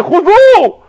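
hoch und laut, mit veränderter Stimme; donnernder Befehl. Acted. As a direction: sprich das Urteil gewaltig aus.

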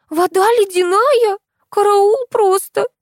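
put-on very high, drawn-out girlish wail; complaining. A narrator's reading. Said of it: "пожаловалась Кристи". (жалобно, высоким протяжным голосом девочки)

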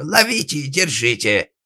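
loud, high-pitched shouted order; yelling. Acted. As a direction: holler after the fleeing thief. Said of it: "заорал папаша".

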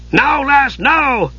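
high, pressing character voice; high-pitched, worked-up urging; urgent panting cry. A narrator's reading. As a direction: urge her on in a high, excited cry. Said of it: "Dugan panted".

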